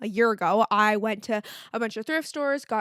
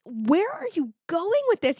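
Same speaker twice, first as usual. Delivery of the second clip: baffled; 7 semitones higher than usual. Retold or imitated. Boyfriend voiced, baffled.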